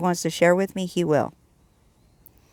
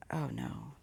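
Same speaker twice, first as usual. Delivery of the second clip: quiet dread; 7 semitones lower, 20 dB quieter than usual. Retold or imitated. Realizing what God asks.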